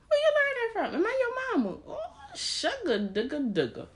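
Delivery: high, sing-song mock mama. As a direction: coo like a doting mama. Mocking mama voice.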